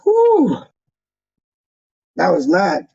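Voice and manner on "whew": high exhaled whew; a real, moved breath out; overwhelmed whew. Real, not acted. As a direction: blow out the emotion in one breath. Overwhelmed after hearing kind words.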